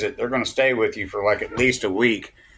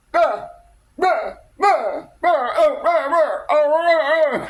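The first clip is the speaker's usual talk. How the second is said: very high, loud mock moaning; mocking imitated moans; 4 dB louder, slower than usual. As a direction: wail wordless bah noises in a high-pitched mocking imitation. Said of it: "I bet it would be like".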